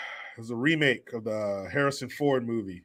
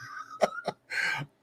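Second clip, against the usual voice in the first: high breathy cackle; 8 dB quieter than usual. Real, not acted. Laugh at pregnancy joke.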